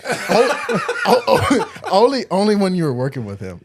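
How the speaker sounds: very high, loud laughing burst; remembering the boss, loud high laughter. Real, not acted. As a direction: crack up while talking.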